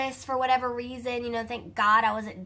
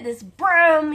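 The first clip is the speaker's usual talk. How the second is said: louder, higher mock wail on "This broom"; 5 semitones higher, 10 dB louder than usual. Fretting to viewers over her broom, mock wail. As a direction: cry out "This broom" in comic despair.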